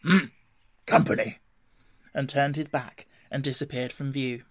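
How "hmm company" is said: grunted badger voice, loud and voiceless; grumpy grunt. A narrator's reading. Badger spots company by the hedge, grunting. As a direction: grunt the word out gruffly. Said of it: "then grunted".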